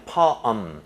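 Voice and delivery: poem slow, blended into one syllable; American pronunciation. Shown as a demonstration. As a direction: model poem slowly the American way. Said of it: "요렇게 발음이 돼요".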